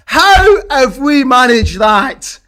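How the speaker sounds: slow, loud, high cry of disbelief; disbelieving shout. Real, not acted. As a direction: yell it out in joyful shock. Disbelief after late winner.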